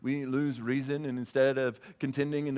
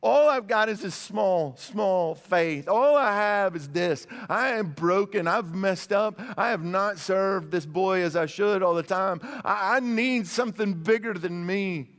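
high, loud, anguished cry of faith; a broken father crying out to Jesus for help, high and loud; desperate plea; 6 semitones higher, 5 dB louder than usual. Pretend. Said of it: "And he says".

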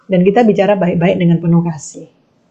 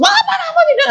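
much higher pitched wife voice, teasing; mock nagging wife; much faster than usual. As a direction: squeak it out like a complaining wife. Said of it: "istrimu bilang".

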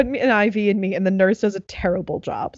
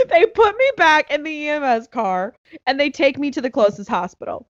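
usual speaking voice

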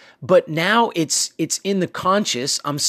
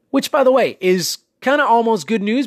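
excited lift; 6 semitones higher than usual. Real, not acted. Upbeat turn in a pep talk.

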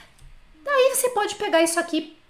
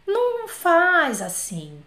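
slow warning; 5 semitones higher, much slower than usual. Shown as a demonstration. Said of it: "teacher warning students".

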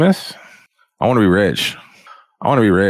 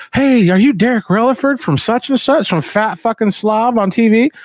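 much higher eager fan voice; excited fan; 12 semitones higher than usual. Retold or imitated. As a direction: gush like a fan spotting a celebrity.

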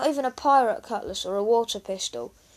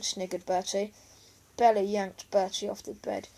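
ordinary delivery, nothing marked

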